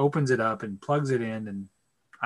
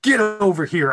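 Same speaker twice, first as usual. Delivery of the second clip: raised, higher call across a room; 6 semitones higher, 6 dB louder, slower than usual. Retold or imitated. Son summoning dad to the shop window, calling out. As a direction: call him over, voice pushed up and louder.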